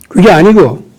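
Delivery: ordinary talk, nothing marked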